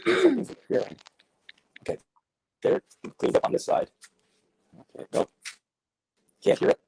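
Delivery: warbly, distorted alien-like call audio. Real, not acted. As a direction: sound like an alien on a bad line. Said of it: "you just sound like a, like an alien".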